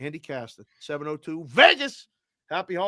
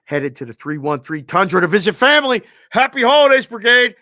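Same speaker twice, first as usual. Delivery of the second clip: hype shout-out; 11 dB louder than usual. Acted. Reading a chat shout-out.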